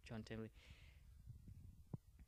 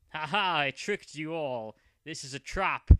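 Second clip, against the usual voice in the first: gloating villain; 8 dB louder than usual. Acted. Villain Joey gloats, trap revealed.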